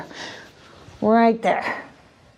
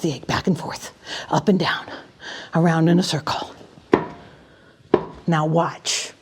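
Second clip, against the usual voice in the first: hushed magician voice; 5 semitones lower, 5 dB quieter than usual. Acted. Hushed magic-act narration.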